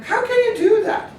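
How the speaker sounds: can't believe she skipped showers; shocked disbelief. Retold or imitated. Mom shocked at daughter.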